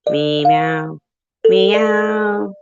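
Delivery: a cat singing a song; meowing a tune. Pretend. Meowing a song for viewers to guess. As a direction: sing the melody all in meows. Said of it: "I'm going to meow it".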